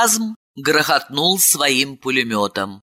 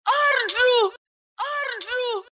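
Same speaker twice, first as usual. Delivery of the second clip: high call fading softer and softer; fading echo; 6 dB quieter, slower than usual. Acted. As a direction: let the name echo away into the vault. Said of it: "Имя дробило эхо".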